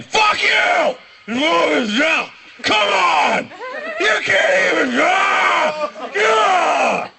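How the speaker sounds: very high, strained yelling; drunken screaming. Retold or imitated. Drunk men fighting across the street, screaming hoarsely. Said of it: "screaming at each other from across the street".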